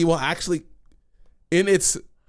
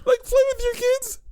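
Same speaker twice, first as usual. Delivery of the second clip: high, weeping laughter over the words; cry-laughing, barely getting words out; laughing to tears. Real, not acted. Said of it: "He's got real tears going on right now".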